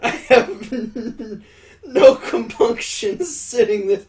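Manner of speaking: loud, high, broken by laughter; laughing through words. Real, not acted. Reader cracking up mid-line, laughing through words. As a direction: struggle to read through real laughter.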